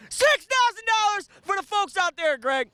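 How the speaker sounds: high, loud pitchman call; hype shout. Acted. Dealer calls the cash price out to viewers, loud and high. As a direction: sell the number to the crowd.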